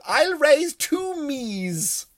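high, slow, plummy queenly drawl; the Queen at poker. Retold or imitated. Queen smugly raising at poker, high and slow. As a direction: stretch it out like a grand old queen. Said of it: "She goes".

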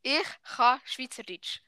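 slow, word-by-word Swiss German; careful Swiss German demo. Shown as a demonstration. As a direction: teach it slowly in Swiss German dialect. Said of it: "Das heisst".

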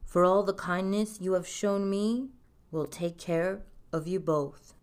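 lightly voiced king; kingly voice. A narrator's reading. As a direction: promise it like a kindly monarch. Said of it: "replied the king".

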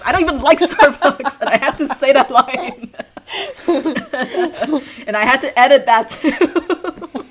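talking through laughter; laughing. Real, not acted. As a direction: laugh while you say it. Laughing at her school line.

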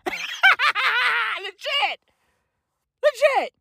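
laughing shout of legit; real laugh on legit. Real, not acted. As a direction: burst out laughing as you say it.

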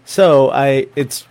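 ordinary talk

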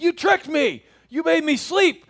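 high, loud mock-indignant baby; outraged baby. Baby waking up to accuse the parent, mock outrage. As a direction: cry out accusingly as a betrayed baby. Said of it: "then they wake up and like".

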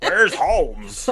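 loud, hammed-up fake American accent; bad American accent. Co-host copying the show's actor, in a loud bad American accent. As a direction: do a Brit butchering an American accent. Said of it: "bad American accent done by a British person".

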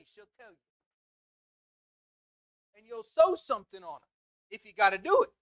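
loud, rising preacher's exhortation; preaching at full force. Acted. Preacher urging the church to serve God, full preaching force.

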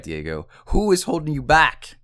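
loud, high, building demand. Retold or imitated. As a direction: push the question up toward a shout. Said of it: "my coach raised his voice".

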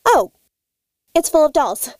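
ordinary talk, nothing marked

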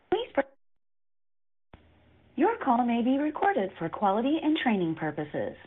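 ordinary delivery

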